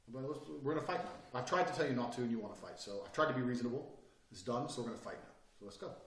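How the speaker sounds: soft, steady, matter-of-fact; calm and quiet. Retold or imitated. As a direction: quietly state the fight is on. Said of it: "that sudden change in body language".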